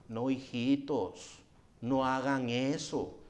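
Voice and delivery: put-on soft, slow, weak old priest voice; a feeble father's mild scolding, drawn out; meek father pleading. Eli gently pleading with his corrupt sons, soft and slow.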